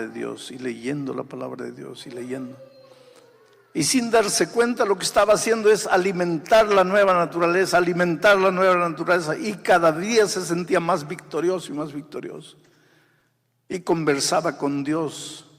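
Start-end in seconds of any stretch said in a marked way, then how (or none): 3.7-15.4 higher and louder testimony climax; preaching at full force. Acted. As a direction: proclaim the victory. (predicación triunfal, más alta y fuerte)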